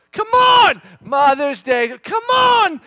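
high, loud dad shout; hammed-up shouted prodding; exasperated urging. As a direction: yell at the kids to join in mid-song. Dad urging silent kids to sing.

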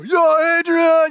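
loud, high, triumphant Rocky shout from the mountaintop; excited Rocky yell. Retold or imitated. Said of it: "he's just super excited".

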